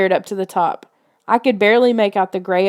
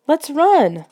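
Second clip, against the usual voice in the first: urgent call; 7 semitones higher than usual. A narrator's reading. Urging in the storm.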